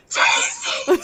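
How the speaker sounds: loud, harsh character cry; imitated scare-actor shout; shouted recognition. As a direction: burst out with the name. Character recognises the returning child.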